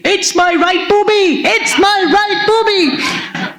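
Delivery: high, slow, drawn-out yell; patient on a trolley yelling her point down the corridor; frantic yelling. Retold or imitated. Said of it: "you could hear me yelling".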